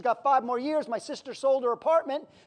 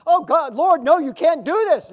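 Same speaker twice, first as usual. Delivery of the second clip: Peter's protest; 3 semitones higher, 10 dB louder than usual. Acted. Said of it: "Kinda sounds like Peter with Jesus".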